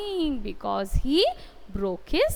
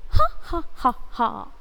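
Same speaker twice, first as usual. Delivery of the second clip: demonstrated laughter; 3 dB louder than usual. Shown as a demonstration. Teacher shows students the action for laughing, mock laugh. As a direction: act out laughing in separate, deliberate beats.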